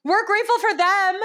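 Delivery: shouting so loud the mic clips; screaming into mic. Real, not acted. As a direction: yell straight into the microphone. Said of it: "stop screaming into the microphone".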